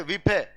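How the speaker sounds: विफे said as "vi-phe", spelled-out misreading of wife; deliberate misreading; mispronounced wife. Shown as a demonstration. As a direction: read wife letter by letter as विफे. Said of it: "वाइफ को बोल देते हैं विफे".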